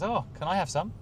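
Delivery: a hungry passerby begging a taste; eager stranger. Retold or imitated. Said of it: "And the guy goes".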